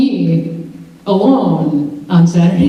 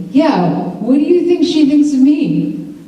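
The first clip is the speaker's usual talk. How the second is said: higher, hopeful asking voice; eager Russ; 8 semitones higher than usual. Retold or imitated. Russ asking his friend about Molly, higher and hopeful. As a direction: pitch it up like a lovestruck guy fishing for hope. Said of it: "And he's like".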